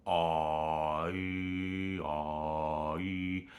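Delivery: vowel Ai said with the tongue shifted; tongue placement demo. Shown as a demonstration. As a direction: shift the tongue while holding Ai. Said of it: "tongue placement".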